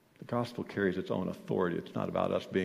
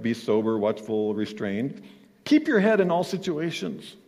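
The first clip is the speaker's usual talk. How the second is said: preaching; 6 dB louder than usual. Acted. Preaching scripture at full force.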